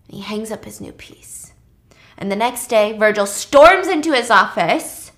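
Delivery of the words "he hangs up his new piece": breathy whisper, voice dropped right down; whispered aside. Shown as a demonstration. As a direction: murmure à voix basse. Repeats the line under breath.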